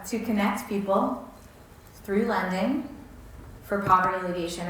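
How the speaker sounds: slow, phrase by phrase with pauses; said slowly. Shown as a demonstration. Founder reciting the mission in three parts, slowly. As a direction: lay out each part slowly, pausing between them. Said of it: "I say it slowly".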